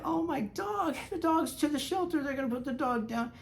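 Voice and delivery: high-pitched voicing of a worried friend; high, soft put-on voice; friend's voice. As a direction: pitch way up to play Kazuko. Retold friend's worried words.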